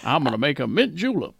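a hammed-up Southern US accent; Southern McCoy accent. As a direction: play it lazy Southern gentleman, Southern accent. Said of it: "His accent comes out of nowhere".

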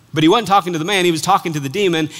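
normal talking voice

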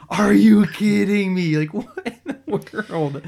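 high-pitched, slow, laughing through the words; laughing disbelief. Retold or imitated. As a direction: laugh in disbelief at the silly scene. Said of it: "I'm like".

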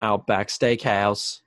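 slow, attempted Australian accent; a slow, failed attempt at an Australian accent. Shown as a demonstration. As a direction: drawl it slowly in a failing Australian accent. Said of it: "I can't even do that".

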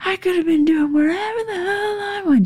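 mock drunk scream. Drunk husband taunting wife, high mock scream. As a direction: imitate a drunk husband jeering. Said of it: "just screaming".

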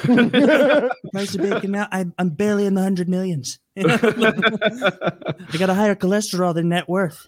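laughing Trump stand-up. Retold or imitated. Continuing Trump stand-up bit.

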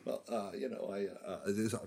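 talking from far away from the mic. Real, not acted. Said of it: "Why are you far away?".

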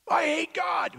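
high, loud mock cry; defiant outburst. Someone rejecting God, high defiant outburst.

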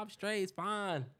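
ordinary delivery

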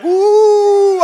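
a very high, loud shouted ooh; mock ecstatic squeal. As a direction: burst out in rapture. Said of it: "You were like".

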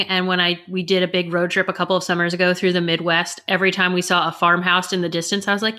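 ordinary talk

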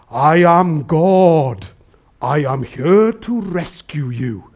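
very slow, low God voice; voice from heaven. Acted. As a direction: draw it out low like a voice from the sky. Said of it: "a voice from heaven that says".

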